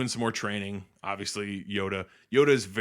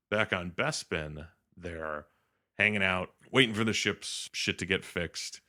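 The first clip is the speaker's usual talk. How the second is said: ordinary talk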